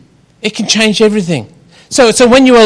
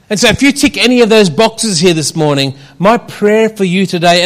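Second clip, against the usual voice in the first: normal talking voice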